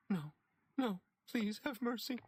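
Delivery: begging for mercy through tears; tearful pleading. A narrator's reading. Woman weeping at dry statue.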